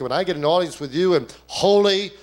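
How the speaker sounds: impassioned sermon climax; preaching at full force. Acted. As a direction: lift it to a holy crescendo.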